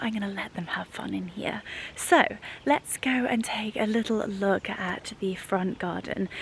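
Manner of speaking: quick hushed whisper. Real, not acted. Owner whispering while leaving her dogs to play. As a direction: whisper so the dogs keep playing.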